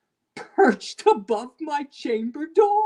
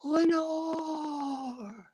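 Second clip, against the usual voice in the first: whispered name; 5 dB quieter than usual. A narrator's reading. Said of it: "was the whispered word".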